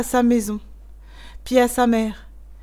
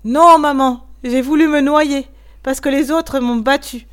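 higher, much louder tearful outburst; child bursting into tears; crying again; 4 semitones higher, 7 dB louder than usual. A narrator's reading. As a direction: cry it out into mother's neck. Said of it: "en se remettant à pleurer".

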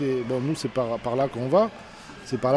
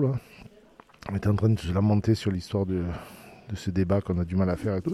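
ordinary talk, nothing marked

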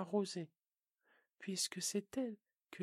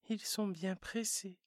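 usual speaking voice

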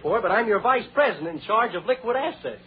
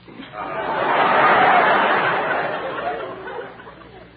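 breathy laugh; 14 semitones lower, 4 dB louder than usual. Real, not acted. Laugh after gag.

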